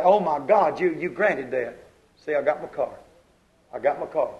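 soft, awed gratitude; quiet believing thanksgiving; hushed thanks. Retold or imitated. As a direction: whisper thanks as if it came. Acts grateful believer receiving car.

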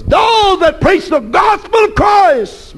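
high, slow preacher's shout; rising shouted sermon line; fiery preaching. Real, not acted. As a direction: cry it out to the congregation. Preaching at full force.

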